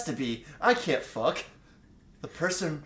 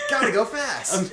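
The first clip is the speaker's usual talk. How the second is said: mock Sonic quote; 6 semitones higher, 8 dB louder than usual. Joke Sonic line.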